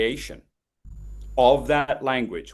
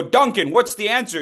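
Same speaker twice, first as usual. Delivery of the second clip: lightly voiced, higher stern teacher; 8 semitones higher, 4 dB louder than usual. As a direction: quiz the kid like a schoolteacher. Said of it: "Your teacher would go".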